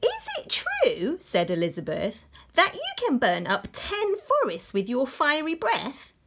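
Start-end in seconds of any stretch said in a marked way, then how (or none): 0.0-1.2 very high, slow princess voice. Acted. Princess questions the dragon. 2.5-6.1 princess voice. Acted. Princess continues.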